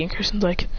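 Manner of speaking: ordinary delivery, nothing marked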